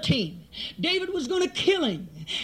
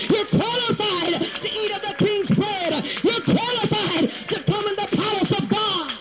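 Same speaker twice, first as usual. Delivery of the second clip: impassioned preaching, rising to a shout; full-force preaching; 3 semitones higher than usual. Real, not acted. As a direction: drive each declaration home to the congregation.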